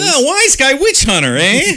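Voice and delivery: very high, loud wiseguy taunt; Stooges wise guy. Pretend. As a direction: sneer it like a Stooge. Three Stooges style jab.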